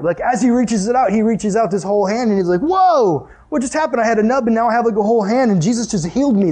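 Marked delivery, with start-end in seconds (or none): 2.6-6.5 amazed healed man. Retold or imitated. Healed man marvels to the crowd, amazed and higher. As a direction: play the man stunned by his new hand.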